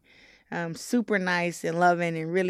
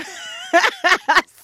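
high burst of laughter; 12 semitones higher, 9 dB louder than usual. Real, not acted. Laughing at grandmother's kitchen rule.

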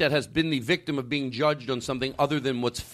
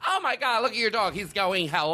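high-pitched, gushing imitation of someone voicing a dog; mocking dog-park stranger; 7 semitones higher than usual. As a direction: put on a silly high voice for someone else's dog. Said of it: "it's the same thing if someone".

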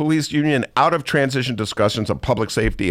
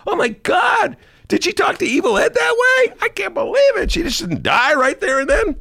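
amazed press. Retold or imitated. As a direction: squeal it in disbelief. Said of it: "the press corps was, like, amazed".

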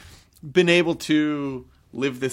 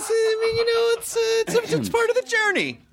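high put-on voice.

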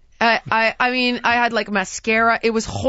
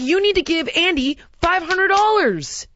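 higher, rising imagined telling-off; pretend tirade; 8 semitones higher than usual. Imagined rant at Christy over the money, rising higher. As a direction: build an imagined rant at her. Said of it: "I wanna be like".

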